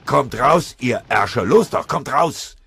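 high, loud enraged yell; furious scream. A narrator's reading. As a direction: scream at the hidden enemy in fury. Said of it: "Neumann, blass vor Wut, schrie".

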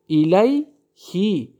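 الیهی drawn out very slowly with a "hi" ending; very slow recited الیهی; example recited. Shown as a demonstration. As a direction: chant الیهی slowly as "ilayhi". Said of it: "یعنی که در بعد همین حرفا".

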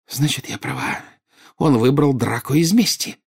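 high, tearful accusation; choked with tears. A narrator's reading. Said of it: "захлёбывалась слезами Нарцисса". (захлёбываясь слезами)

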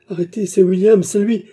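very high, loud scream; desperate scream. A narrator's reading. Baccarat trying to stop the agents taking her lover, screaming. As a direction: throw everything at stopping them. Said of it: "en leur criant".

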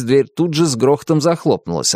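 plain reading voice

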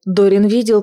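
plain reading voice

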